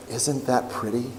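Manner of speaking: lightly voiced child, softly amazed; toddler's soft wonder. Toddler pointing out the butterfly, softly amazed.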